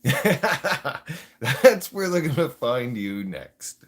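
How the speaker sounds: slow, laughed-out words; laughing. Real, not acted. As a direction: say it through your own laughter. Laughs at his own threat.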